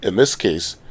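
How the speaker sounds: usual speaking voice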